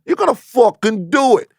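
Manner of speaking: a hammed-up heavy, drunk with power; Ben Lindbergh the mob boss. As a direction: lean on him like a loan shark, slowly. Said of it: "He's like".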